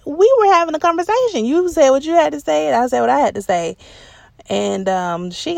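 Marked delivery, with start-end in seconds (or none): 0.0-3.8 loud, high, fast telling-off of a relative; exasperated retort. Retold or imitated. Retelling how she answered the family member who got mad. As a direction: snap back at her, much higher and louder. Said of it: "I'm like".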